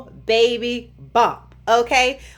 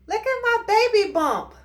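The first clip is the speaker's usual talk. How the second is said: playful showing off; 11 semitones higher than usual. Real, not acted. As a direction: show it off in a high voice. Proudly shows off bump.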